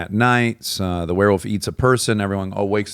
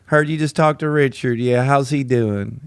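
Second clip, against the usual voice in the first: higher, drawling Southern caller; Southern brother; 4 semitones higher than usual. Retold or imitated. Brother calling the same woman, Southern drawl. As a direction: imitate the Southern brother on the phone. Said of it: "now it's his brother".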